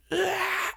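very high, restrained imitation scream; mock scream. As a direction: squeal out a pretend scream without full volume. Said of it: "screams so hard".

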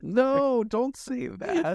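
mock horror. Real, not acted. Friend begging him not to say it, shrill mock horror. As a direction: recoil in playful horror.

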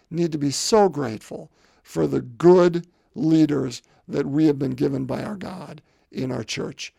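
ordinary delivery

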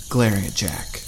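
plain reading voice

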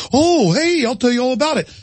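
high, eager cop won over by groveling; imitating a cop warming up; mock suddenly friendly cop. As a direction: play the cop turning sweet. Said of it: "And they'll go".

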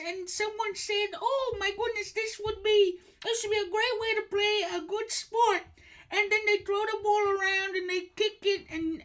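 put-on a shrieking delighted old woman; high old lady voice. Host in falsetto as psychic, gushing shrill greeting. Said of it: "doing the voice".

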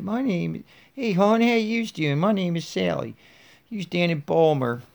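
high-pitched, hammed-up Baltimore accent. Shown as a demonstration. Podcaster trying a Baltimore accent for Sally, exaggerated and high. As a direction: put on a high Baltimore hon voice. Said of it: "give her a Baltimore accent".

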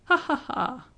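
high jeering ha ha ha; mocking laughter. A narrator's reading. Students jeering at the departing Benjamin, high. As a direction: laugh at him as he leaves. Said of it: "the undergraduates laughed".

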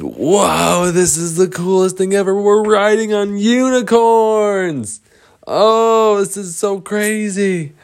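high, loud whooping excitement; thrilled. Acted. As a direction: cry out as if on a wild ride. Boys thrilled riding galloping unicorns.

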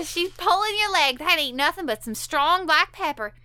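lightly voiced mom. Quoting the mom, raised pitch.

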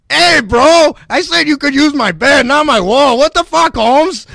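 high, loud Chicano bro voice; Eddie Guerrero impression. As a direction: yell it like a wrestler, pitched high. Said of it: "You doesn't sound like that. You sound like Eddie Guerrero".

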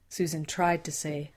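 usual speaking voice

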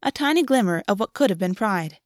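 ordinary delivery, nothing marked

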